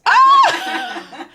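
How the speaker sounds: high, loud laughing shout; excited shriek. Real, not acted. Host squealing at cousin's hedge, high laughing shout. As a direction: squeal in playful outrage. Cousin says she'll only try.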